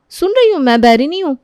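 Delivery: high, loud, testy comeback; irritated retort. Acted. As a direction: snap that you are listening. Irritated sister-in-law's retort. (चिढ़कर ऊँची आवाज़ में)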